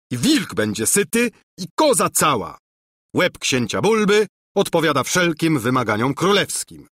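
tickled by his own trick; gleeful captain. A narrator's reading. Captain gloats with joy. (uradowany kapitan)

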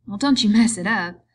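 loud, sharper warning. A narrator's reading. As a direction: warn him off firmly. Wife warns Lenny off her hair.

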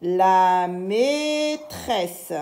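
very slow syllable-by-syllable reading; drawn-out syllables. Shown as a demonstration. Teacher reads aloud for learners, very drawn-out syllables. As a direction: stretch every syllable right out. Teacher models word slowly, then normally.

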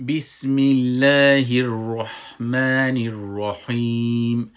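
slow, loud melodic Quran recitation; with a heavy rolled r in الرحمن الرحیم. Shown as a demonstration. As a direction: chant it slowly with full voice.

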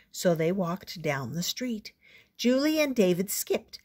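ordinary delivery

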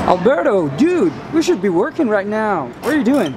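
high, loud teasing scold, as if catching him slacking; mock scolding. Real, not acted.

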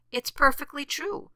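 high-pitched smug rabbit; bragging. A narrator's reading. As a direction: lift the voice high and show off. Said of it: "bragged the rabbit".

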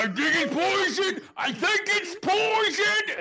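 high frantic yell; panicked shout. Acted. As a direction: shriek the warning in panic.

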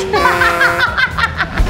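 high giggling burst; bright laugh. Real, not acted. Laughs at staff watching.

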